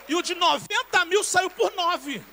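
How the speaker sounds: loud, high and slow; slow loud preaching climax; triumphant proclamation. Real, not acted. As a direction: proclaim the miracle to the crowd. Sermon climax, testimony punchline.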